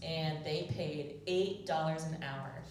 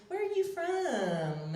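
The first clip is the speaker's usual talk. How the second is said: put-on high, slow old lady voice; old lady asking; 12 semitones higher, 4 dB louder, slower than usual. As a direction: ask it like a curious old lady. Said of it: "And like".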